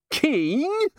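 high, rising incredulous question; spluttering shock. A narrator's reading. Nobby is offered the crown, choking with shock. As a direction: yelp it in disbelief. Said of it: "Nobby coughed".

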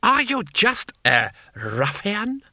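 high, slow outraged question; screamed disdain. A narrator's reading. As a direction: cry out in haughty outrage. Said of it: "screamed Lord Hong".

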